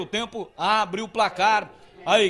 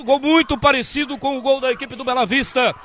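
ordinary talk

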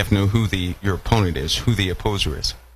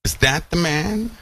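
rising to a high, squeaking "Really?"; incredulous mock question climbing high; disbelief; 8 semitones higher than usual. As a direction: build from doubt to shocked disbelief. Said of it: "people will look upon Satan and say".

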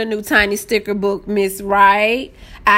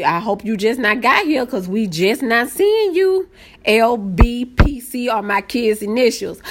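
ordinary talk, nothing marked

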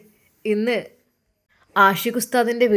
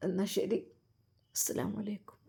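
much softer, whispered farewell through tears; choked whisper; 12 dB quieter than usual. Acted. Mother bidding her son farewell, in a choked whisper. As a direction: voice failing as she says goodbye. Mother's tearful final goodbye.

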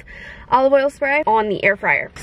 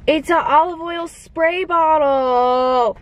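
slow, higher, drawn-out delight; excited squeal; 4 semitones higher, much slower than usual. Real, not acted. Vlogger opens the gift she wanted, excited squeal. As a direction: squeal over the very thing you wanted.

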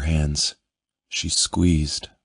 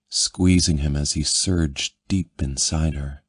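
ordinary delivery, nothing marked

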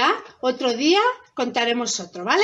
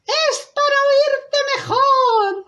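high, slow fake-granny voice; wolf as grandmother; 9 dB louder, slower than usual. Wolf in disguise answering the girl, high drawn-out voice. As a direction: draw it out high, playing granny. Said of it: "como si fuera la abuelita".

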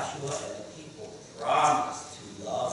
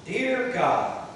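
leading prayer; 9 semitones higher, 6 dB louder than usual. Shown as a demonstration. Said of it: "everyone repeat after me".

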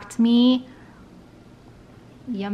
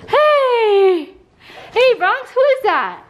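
high, excited greeting to a dog; excited dog talk; 8 semitones higher, slower than usual. Real, not acted. Owner calls dog to greet sister, excited. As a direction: call the dog's attention in a high, thrilled voice.

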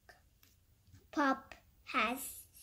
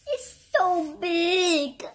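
slow, higher, louder wonder; amazed exclamation; 4 semitones higher, 6 dB louder than usual. Real, not acted. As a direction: stretch it out loudly, amazed at the size. Amazed at size of picture.

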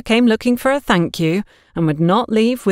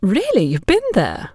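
surprised; 4 semitones higher than usual. Acted. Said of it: "didn't disguise her surprise".

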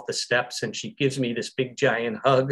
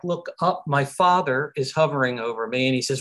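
ordinary delivery

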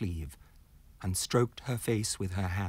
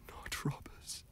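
whispered, breathless denial; gasped terror; 13 dB quieter, slower than usual. Acted. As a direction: gasp it out in terror. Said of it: "gasped the terrified man".

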